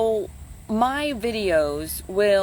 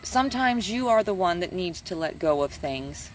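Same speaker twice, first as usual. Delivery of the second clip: normal talking voice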